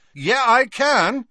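loud, high, drawn-out retort; defiant. A narrator's reading. Harry overriding his friend's protest, defiant. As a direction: insist slowly and forcefully.

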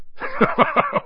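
big amused chuckle. Acted. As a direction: chuckle warmly before speaking. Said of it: "Hagrid chuckled".

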